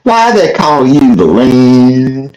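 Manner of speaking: mock Southern accent, very drawn out; fake Southern accent. Caller re-enacting his trolling of the host, fake Southern accent. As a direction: stretch each word slowly in a put-on Southern accent. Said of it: "I had my fake Southern accent".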